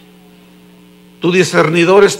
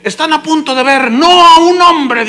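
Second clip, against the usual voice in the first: presenter's hype; 7 semitones higher, 5 dB louder than usual. Retold or imitated. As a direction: hype the big reveal like an event presenter. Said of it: "el que lo estaba presentando".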